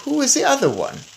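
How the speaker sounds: curious grandmother. A narrator's reading. Grandmother asking about the other mouse, old voice. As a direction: ask it like an old lady peering.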